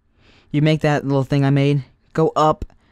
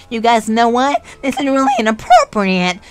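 higher, louder mock scolding teacher; teacher telling the class off, voiced higher and louder; imitating the teacher; 7 semitones higher, 6 dB louder than usual. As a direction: put on the stern teacher scolding the group. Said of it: "She was like".

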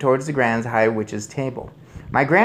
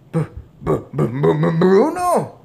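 shocked stammer; 6 semitones higher, 6 dB louder than usual. A narrator's reading. Father sees mouse son, stammering. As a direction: get stuck on the first sound. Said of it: "stammered Mister Jenkins".

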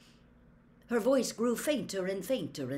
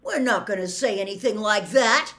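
loud, higher, urgent protest; shouting; 4 semitones higher, 10 dB louder than usual. A narrator's reading. Harry protests to the angry centaurs, shouting. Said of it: "Harry shouted".

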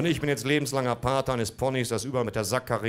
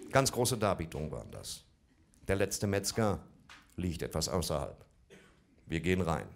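ordinary talk

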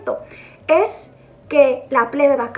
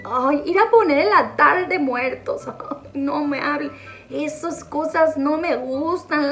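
ordinary delivery

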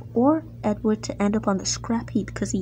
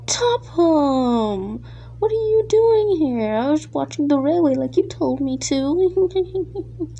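hammed-up higher voice, caught out; flustered impostor; 5 semitones higher, slower than usual. Impostor caught by the real Topham, flustered and high. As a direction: squeal in surprise, then drag out the excuse.

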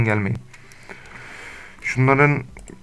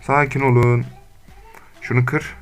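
ordinary talk

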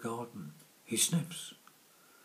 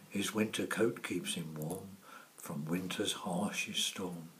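plain reading voice

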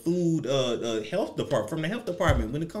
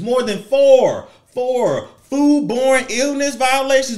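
More than four hammered loud and slow; louder, slower emphasis on the count; stress on four; 6 semitones higher, 8 dB louder, slower than usual. Real, not acted. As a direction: punch four and foodborne. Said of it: "emphatic repeat".

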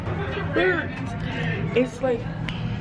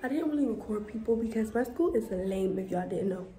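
hushed voice; 10 dB quieter than usual. Real, not acted. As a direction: keep it down, others nearby. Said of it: "In the bathroom".

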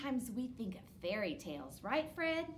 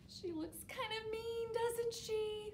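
mock scared; 12 semitones higher, 4 dB quieter than usual. Showing the troll cover.

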